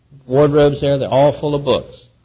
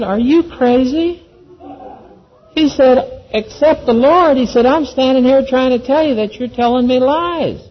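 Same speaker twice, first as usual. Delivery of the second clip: mock outraged disbelief; 11 semitones higher than usual. Husband outraged at the question.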